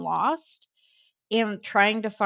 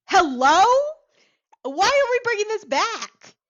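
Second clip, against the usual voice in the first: high, loud, disbelieving questions; incredulous; 14 semitones higher, 7 dB louder than usual. Retold or imitated. Speaker reacting to a slur from an Italian American man, incredulous. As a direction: call them out in high-pitched disbelief.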